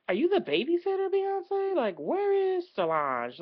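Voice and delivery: mock interviewer. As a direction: needle her with a sharp, high jab. Mock question to [name].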